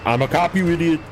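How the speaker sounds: a hint of Arnold, rising to a high yell; lightly voiced Arnold Schwarzenegger; Arnold impression. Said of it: "my favorite Arnold quote is".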